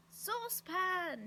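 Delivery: high, drawn-out shout; yelling. A narrator's reading. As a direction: yell the name long and loud. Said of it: "he yelled".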